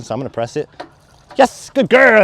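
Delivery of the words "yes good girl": excited dog praise. Real, not acted.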